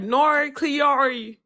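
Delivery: slow, drawn-out broad Australian vowels, pitch up; broad Australian accent. Shown as a demonstration. Asked to prove she's Australian, a slow broad Australian drawl. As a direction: lay the Australian on thick and slow. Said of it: "what do you want me to sound like?".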